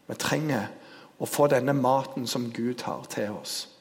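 ordinary talk

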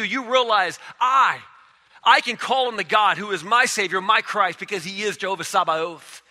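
high, loud shouting proclamation; rally them against their Goliath; full-force preaching. Acted. Preacher rallying listeners facing a Goliath, loud shout.

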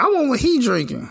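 high mock girly voice; mimicking the woman. Voicing the woman at the bar.

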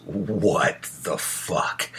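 a partner's slow, exasperated disbelief; drawn-out baffled "what the fuck". Retold or imitated. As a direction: let it hang in slow exasperation. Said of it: "she looks at me and goes".

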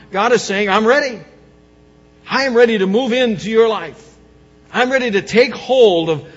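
loud, high declaiming sermon; thundering preacher; full-force preaching. Acted. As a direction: proclaim God's readiness with fire. Preaching God's promise at full force.

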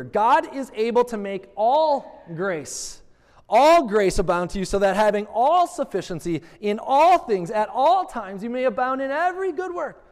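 "all" leaned on every time, slowed; stress on all. Shown as a demonstration. Pastor repeating the verse, pressing "all". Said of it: "I want to emphasize a word here".

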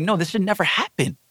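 understated mad protest; retold anger. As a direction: insist in retold outrage. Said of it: "I was so mad".

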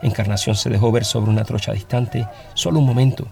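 ordinary delivery, nothing marked

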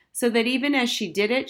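usual speaking voice

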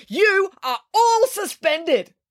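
high, slow furious teacher voice; Mister Lang yells a suspension at the kids; yelling. A narrator's reading. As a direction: spell out the punishment in a rage. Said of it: "yelled Mister Lang".

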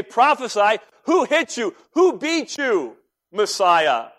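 high-pitched, goading taunt; mocking crowd. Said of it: "They begin to mock Christ".

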